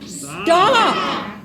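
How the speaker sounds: loud commanding cry of Stop; shouted stop. Shown as a demonstration. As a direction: cut it off with one big shout of Stop. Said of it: "want to just shout, "Stop".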